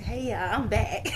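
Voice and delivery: amused, laugh breaking in; laughing greeting. Acted. Returning spirit greets everyone, laughing. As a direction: announce it through laughter. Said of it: "She said".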